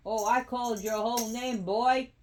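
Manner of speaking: loud, high telling-off; scolding. Real, not acted. Owner tells the cat they called its whole name, scolding.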